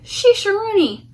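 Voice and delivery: silly fed-up exclamation; exasperated. Acted. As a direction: throw up your hands.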